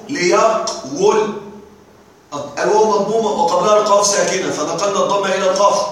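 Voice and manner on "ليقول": "ليقول" slowly broken as li-yaq... wul; underlying form, split. Shown as a demonstration. Teacher reminding students of the original form, split slowly. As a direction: pause after the q in "ليقول", then sound the w. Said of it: "أصله".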